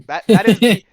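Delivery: loud and high, almost laughing; excited exclamation. Real, not acted. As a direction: burst it out, cracking up.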